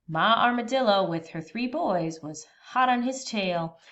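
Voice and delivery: ordinary delivery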